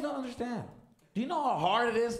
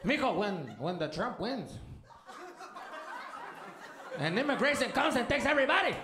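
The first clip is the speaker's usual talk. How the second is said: panicked immigrant dad; 6 semitones higher than usual. Retold or imitated. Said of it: "immigrant dad voiced in story".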